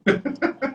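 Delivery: high burst of laughter. Real, not acted. Laughs after the joke.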